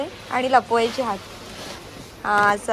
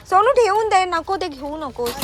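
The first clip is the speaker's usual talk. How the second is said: firm scolding; 7 semitones higher than usual. Real, not acted. Mother scolds child to put back.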